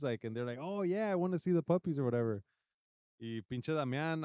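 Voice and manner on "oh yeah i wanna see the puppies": mock eager kid. Kid naively agreeing in video.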